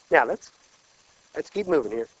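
ordinary talk

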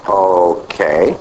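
low, drawn-out okay ending on a rise; retold puzzlement, dropped deep and questioning; dubious okay. Preacher recalling his puzzled reaction, low and dubious. Puzzled reply to odd statement.

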